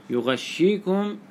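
يغشيكم with a held double sh, yu-ghash-shee-kum; doubled shin. Shown as a demonstration. Teacher giving our reading, يغشيكم as yughashshikum. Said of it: "بتشديد الشين".

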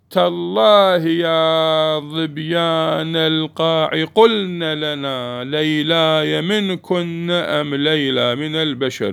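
slow, drawn-out recital of the verse; poem recitation. Shown as a demonstration. Teacher reciting the example verse to the class, slow and drawn out. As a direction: chant the old line slowly, savouring تالله. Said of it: "نحو".

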